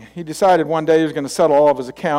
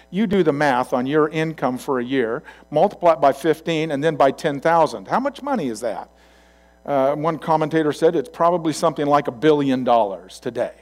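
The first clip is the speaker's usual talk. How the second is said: usual speaking voice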